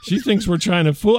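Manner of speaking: normal talking voice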